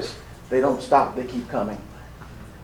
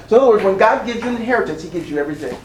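ordinary delivery

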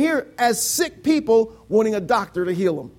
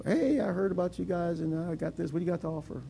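casual email inquiry; 3 semitones lower, 9 dB quieter than usual. Retold or imitated. Voices a woman's inquiring email.

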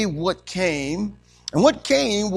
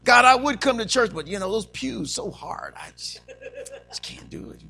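feeble excuse; 5 semitones higher than usual. Pretend. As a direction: pretend to be too weak for it. Acting a feeble excuse to skip church.

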